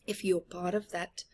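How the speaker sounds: ordinary delivery, nothing marked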